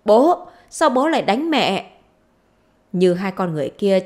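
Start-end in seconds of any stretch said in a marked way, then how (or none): 0.1-1.9 high, raised-voice protest; shouting. A narrator's reading. Daughter rushing in on father hitting mother, shouting. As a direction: call out in alarm to stop him. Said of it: "lao vào nhà hô lớn".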